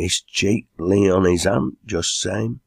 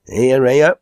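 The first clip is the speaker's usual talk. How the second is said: cheer; 6 semitones higher, 6 dB louder than usual. A narrator's reading. Cheering Enoch's offer to sing.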